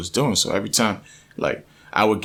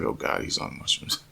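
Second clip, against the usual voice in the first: hushed, voiceless mutter; exasperated whispered aside; imitated wife's aside; 8 dB quieter than usual. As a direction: say it under the breath as the wife.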